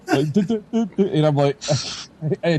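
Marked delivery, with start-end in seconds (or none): 0.3-1.1 higher, laughing stammer of shock. Retold or imitated. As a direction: stutter the word over and over, half laughing. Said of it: "I couldn't even talk".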